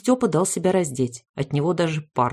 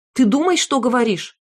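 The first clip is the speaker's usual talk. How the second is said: higher, sharp rebuke; angry shout; 6 semitones higher, 3 dB louder than usual. A narrator's reading. Wife rebuking her husband, angry. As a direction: snap at the husband. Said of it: "закричала она".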